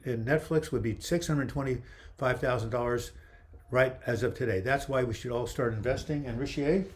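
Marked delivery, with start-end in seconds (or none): 6.2-6.8 "Richier" said the French way, ending in "ay"; French-style name. Real, not acted. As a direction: give "Richier" a French pronunciation, ree-shee-AY. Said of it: "I'm pronouncing it like it's French".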